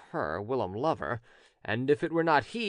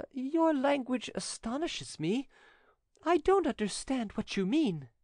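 high-pitched lady claiming bewilderment; astonished Angelique; 12 semitones higher than usual. Acted. Voices Angelique, feigning astonishment.

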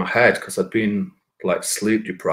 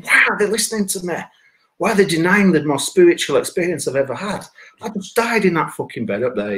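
higher-pitched indignant retelling; really annoyed; 8 semitones higher than usual. Retold or imitated. Dead man annoyed that nobody listens, mock indignant. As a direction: protest incredulously, pitched up. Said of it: "I was getting really annoyed".

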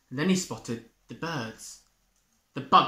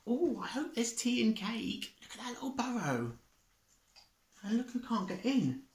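much higher, softer chattering; tiny creatures; 8 semitones higher, 5 dB quieter than usual. Acted. Small woodland creatures chatter as they skip through. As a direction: twitter excitedly like small animals.

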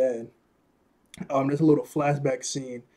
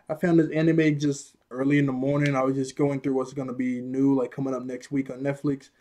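ordinary talk, nothing marked

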